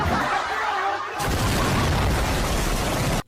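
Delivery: long high-pitched giggle; high giggly laugh. Real, not acted. Vlogger amused by a mosquito, high giggly laugh. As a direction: break into a squeaky giggle. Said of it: "Nagpapatawa yung lamok".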